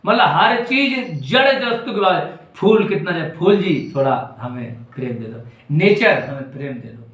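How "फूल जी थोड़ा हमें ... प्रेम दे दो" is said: mock beggar's plea. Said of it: "जा रहा भिखारी बन के".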